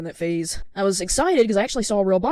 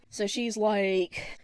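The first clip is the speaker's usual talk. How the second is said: usual speaking voice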